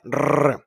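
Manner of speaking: a loud trilled Rrr like a motorbike; rolled R. Shown as a demonstration. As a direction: roll the Rrr like an engine. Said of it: "like a motorbike".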